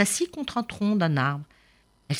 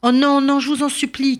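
higher and louder, begging; pleading cry; 10 semitones higher, 6 dB louder than usual. Acted. Maïni begging the old man to spare Hura, pleading cry. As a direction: cry out for mercy. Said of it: "cria Maïni".

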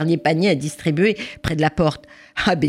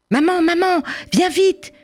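put-on child calling; 13 semitones higher than usual. Child calling mother to the door, urgent high call. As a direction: call for mum in a hurry.

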